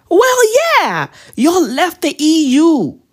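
high-pitched, incredulous "obviously"; exasperated retort. Acted. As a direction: shoot up high as if it's obvious.

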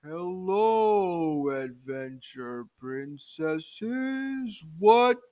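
very slow, drawn-out royal greeting; giant turtle king. Acted. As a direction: play a ponderous turtle king, stretching the words. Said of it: "the giant turtle king, uh, comes out and says".

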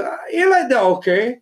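high, half-hearted verdict on a friend's set; imitating Steve. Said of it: "it'll be like".

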